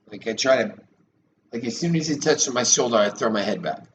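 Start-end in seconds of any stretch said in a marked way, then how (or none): none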